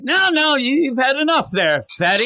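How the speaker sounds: high, hammed-up refusal; mock scolding mother. Mother refusing her kid seconds, high mock scolding.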